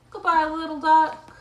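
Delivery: high, loud storybook goodbye; cheery farewell. Acted. Owner calling goodbye to the little duck, high and cheery. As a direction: call a big goodbye to a duckling.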